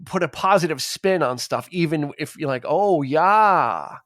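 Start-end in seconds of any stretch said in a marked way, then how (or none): 2.7-4.0 Minnesota Nice lilt, a mock-cheery "Oh, yeah". Shown as a demonstration. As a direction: sing it up with Minnesota politeness. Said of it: "lilts upward when".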